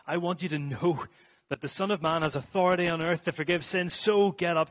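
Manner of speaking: forceful proclamation, surging at the end; breaking into a command. Acted. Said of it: "breaks off from his sentence".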